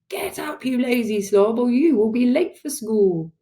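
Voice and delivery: cross father scolding; father's real voice. A narrator's reading. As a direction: rouse a lazy child crossly. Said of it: "my father's real voice".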